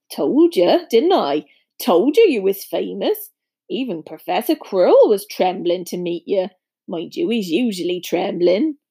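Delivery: rustic, pleased I-told-you-so; big friendly fellow, gently crowing; Hagrid, genial giant. Acted. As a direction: speak as a hearty country giant.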